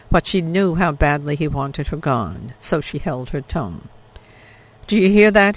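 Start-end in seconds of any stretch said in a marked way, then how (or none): 4.9-5.6 sudden, louder, higher alarm; alarmed question. Acted. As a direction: snap to attention at a strange noise. Father alarmed by strange sound.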